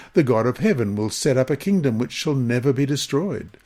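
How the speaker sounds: ordinary talk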